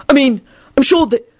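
high, flustered stammering. Acted. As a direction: scramble for words in panic. Flustered backpedalling.

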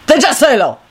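quick burst of irritation; irritable snap. A narrator's reading. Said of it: "he snapped irritably".

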